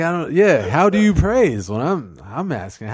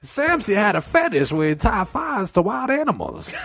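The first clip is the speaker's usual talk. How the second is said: high storyteller voice; 9 semitones higher than usual. Pretend. Narrator character keeps escalating.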